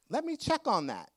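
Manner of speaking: perky. Retold or imitated. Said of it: "And she said".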